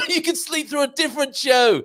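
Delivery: cracking up at a viewer's joke; giggly and loud; laughing. Real, not acted.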